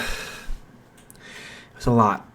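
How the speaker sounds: worn-out whisper. Acted. Izuku admits he is overwhelmed.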